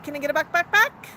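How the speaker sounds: high request leaping into loud clucks; coaxing clucks. Pretend. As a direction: cluck upward to prompt a bird. Coaxing the bird to cluck back.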